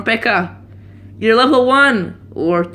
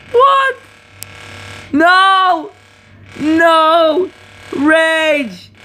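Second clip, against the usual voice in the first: much higher and louder no; dismayed yell; 9 semitones higher, 4 dB louder, much slower than usual. Real, not acted. Player watching his tower fall, yelling in dismay. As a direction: cry out as the tower falls. Losing the match.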